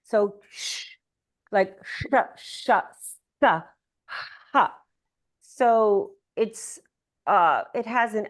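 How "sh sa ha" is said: sh, sa, ha pushed out as steam-like air; airy sibilant demo; hissing breathy consonants. Shown as a demonstration. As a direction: let sh, sa and ha hiss out like steam from a pot. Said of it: "It's like steam coming from the pot".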